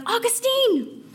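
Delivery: high, drawn-out, excited call of his name; a former companion thrilled to spot him; excited greeting. Retold or imitated. Old companion greeting Augustine on the street, high and excited. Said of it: "she approached him excitedly".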